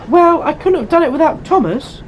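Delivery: high, loud little engine voice; excited small train character; delighted Percy. A narrator's reading. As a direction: give credit in an eager, high voice. Percy answers praise from controller.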